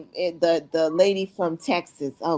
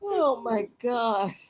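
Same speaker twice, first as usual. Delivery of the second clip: slow disbelief; 3 semitones higher, 4 dB quieter, much slower than usual. Real, not acted. Reacting to the fake vet story.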